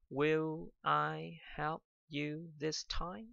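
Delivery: "Will I help you this time?" read very slowly and softly; slow dictation. Shown as a demonstration. A teacher reads the finished example slowly and softly. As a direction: lay out each word slowly for dictation. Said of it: "Được chưa".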